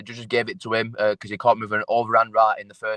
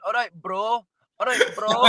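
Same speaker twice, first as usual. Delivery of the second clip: higher, laughing Scouse 'all right, bro'; mock Scouse greeting; 11 semitones higher than usual. Fighter mimicking the Scouse gym lads teasing him, laughing Scouse accent. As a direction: ham up the Liverpool lads' catchphrase. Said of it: "they keep going".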